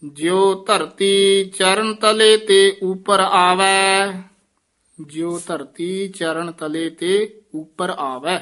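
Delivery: slow, drawn-out chanted reading; slow devotional chant; Gurbani recitation. Acted. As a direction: intone each line like scripture. Chants the hymn slowly.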